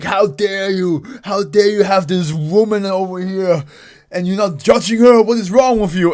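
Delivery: hammed-up offended friend; mock outrage. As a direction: play the offended guy big. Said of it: "and be like".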